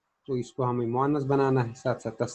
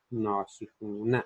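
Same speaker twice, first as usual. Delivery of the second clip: slow answer; 3 dB quieter, much slower than usual. Shown as a demonstration. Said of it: "तो जमा में हम क्या कहेंगे?".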